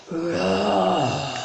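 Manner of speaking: a first, restrained roar. Acted. Dragon heard from the cloud, restrained roar. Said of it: "a roar that was scary".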